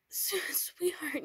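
breathy laugh into a high whispered sweetheart. Acted. Boyfriend laughing tenderly to calm his crying girlfriend. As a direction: laugh gently as you soothe her.

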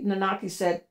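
plain reading voice

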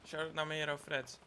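usual speaking voice

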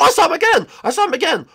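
scared cry. Acted. Startled scream on seeing him.

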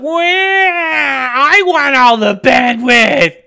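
whiny high shout, slow and drawn out; hammed-up jealous girlfriend wail; greedy app voice. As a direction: voice a spoiled program demanding everything. Voicing greedy computer app.

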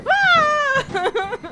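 very loud, high startled scream; scream of fright. Real, not acted. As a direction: let out a full scream. Final jump scare.

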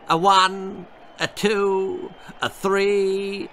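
high, loud mock referee count. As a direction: count him out like a gloating referee. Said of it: "he mocked".

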